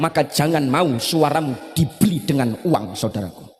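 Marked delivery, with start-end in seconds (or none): none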